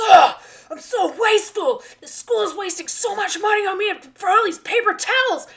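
high, very loud tearful wail; hammed-up self-blaming despair; mock meltdown. Teacher imitating boy pacing the hallway, mock wailing meltdown. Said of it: "he cannot get over this".

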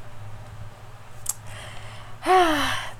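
a long breathy sigh of being moved; touched sigh. Real, not acted. Teacher moved by a student's heart drawing, long sigh. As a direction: let the feeling out in one long breath.

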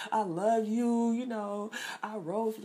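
high, slow, hammed-up teary pleading; mock crying plea. Imagining Jennifer pleading tearfully to Khalil, mock crying. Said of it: "like, crying and".

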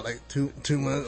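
ordinary talk

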